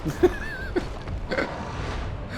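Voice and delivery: airy nervous laugh rising up; breathy panicked laugh. Real, not acted. Fleeing a boss.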